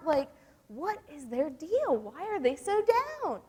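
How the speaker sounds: acted-out bewilderment, pitched high and emphatic; mock-baffled protest on someone's behalf; baffled guys. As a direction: plead in exasperated disbelief, voice climbing high. Acting out baffled boyfriends' complaint.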